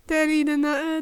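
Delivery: ordinary delivery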